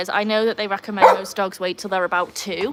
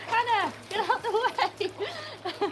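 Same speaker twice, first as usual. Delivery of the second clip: high-pitched, drawn-out call to a dog; slow, high plea from a distance; exasperated calling out; 12 semitones higher, 6 dB quieter, much slower than usual. Real, not acted. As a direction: shoo the dog with a laugh. Shooing the dog while working.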